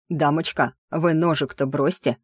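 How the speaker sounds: ordinary delivery, nothing marked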